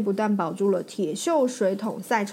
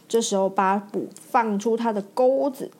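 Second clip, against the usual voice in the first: ordinary delivery, nothing marked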